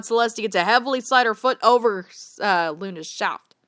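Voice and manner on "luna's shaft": usual speaking voice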